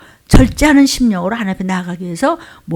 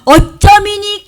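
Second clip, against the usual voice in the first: high, loud, drawn-out mock wail; wailing complaint; 11 semitones higher, 7 dB louder, much slower than usual. Believer demands an answer from God, wailing complaint. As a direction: stretch it into a tearful, protesting cry. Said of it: "푸념을 얘기하는 것이에요".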